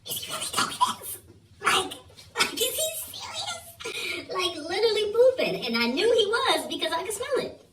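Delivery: high, fast, laughing astonishment; laughing disbelief. Real, not acted. Telling viewers, high and laughing in disbelief. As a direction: squeal it through laughter.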